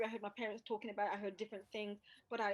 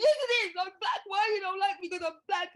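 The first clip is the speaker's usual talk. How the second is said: high, loud sobbing protest; crying child; 11 semitones higher, 12 dB louder than usual. Retold or imitated. Child crying at the headmaster who refused her. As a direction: wail it like a hurt five-year-old. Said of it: "And I'm going".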